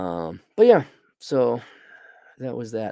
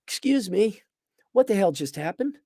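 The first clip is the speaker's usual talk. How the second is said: higher, mock-incredulous question; baffled retort; 8 semitones higher than usual. Reviewer reliving the sudden ending: mock disbelief. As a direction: act stunned by the abrupt ending. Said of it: "And I was like".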